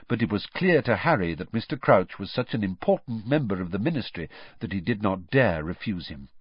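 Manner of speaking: ordinary delivery, nothing marked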